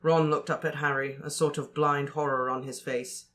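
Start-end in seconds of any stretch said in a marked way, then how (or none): none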